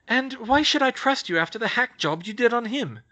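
put-on crisp voice of a young witch, wary; young witch, brisk. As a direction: Hermione taking charge of a tense meeting. Hermione challenging.

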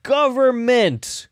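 high, drawn-out cry of government; conspiracy guy yell. Retold or imitated. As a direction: stretch it out, high and outraged. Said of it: "the other guy's like".

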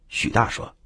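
plain reading voice

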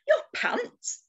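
high-pitched astonished question; surprised. A narrator's reading. Shark learning whose pants they are, high surprised question. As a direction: query it in storybook astonishment. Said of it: "The shark looked quite surprised".

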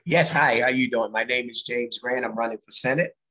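ordinary talk

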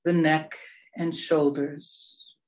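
slow, spacious meditation voice, long pauses between phrases; guided meditation. Real, not acted. Meditation leader guiding listeners through breaths and body relaxation, slow meditation voice. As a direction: draw the words out to guide them into relaxation.

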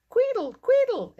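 very high, loud squeal; bird call. A narrator's reading. Mole's new whistle calls a jay, high loud piping. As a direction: pipe it shrilly like a jay. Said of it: "it called".

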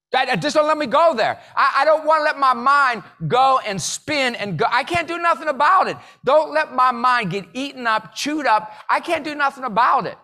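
urgent, high-pitched pleading; impassioned plea. Acted. Man praying over what he can't change, impassioned pleading. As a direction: pray it hard, pushed high and insistent. Acts out his impassioned morning prayer.

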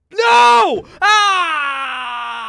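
high, loud cry of defeat; anguished scream. Real, not acted. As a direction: scream as you lose. Dies in horror game.

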